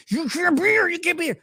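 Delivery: high-pitched mock yelling; hammed-up repeated scolding, no real volume; retold screaming. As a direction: imitate a man ranting in a high voice. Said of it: "just screaming at her".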